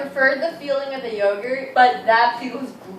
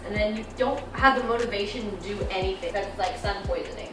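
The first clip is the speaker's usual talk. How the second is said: ordinary talk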